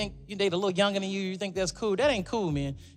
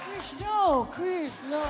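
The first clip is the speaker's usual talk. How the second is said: [name]; 9 semitones higher, much slower than usual. Retold or imitated. Singer stopping Chris from singing along, high imitated voice. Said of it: "And Michael was like".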